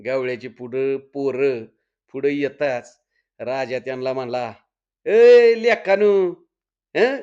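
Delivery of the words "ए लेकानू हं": loud, high challenging shout; bossy official hailing passers-by; calling out sternly. Acted. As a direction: holler at them to halt them, drawing out the call. Accosts woodcutters, posing as forest officer.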